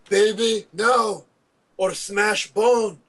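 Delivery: a brute giant, slow and threatening; chest-beating giant. Acted. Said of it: "he beats on his chest and says".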